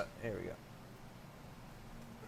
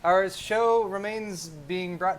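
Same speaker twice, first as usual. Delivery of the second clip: slow, louder sponsor read; 5 semitones higher, 7 dB louder, slower than usual. Acted.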